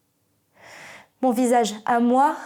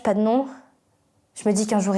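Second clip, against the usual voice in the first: ordinary talk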